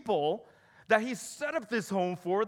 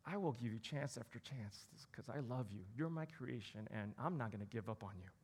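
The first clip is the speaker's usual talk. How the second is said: much softer, gentle and loving; tender God; 8 semitones lower, 13 dB quieter than usual. Pretend. God promising his people, soft and tender. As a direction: soothe them like a patient father. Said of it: "But he says".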